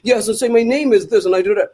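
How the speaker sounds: mock royal English interview voice; affected establishment accent. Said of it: "in interviews he's like".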